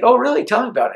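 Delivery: interested surprise. Retold or imitated. Star intrigued by amp pitch.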